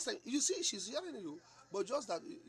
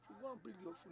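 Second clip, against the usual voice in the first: ordinary talk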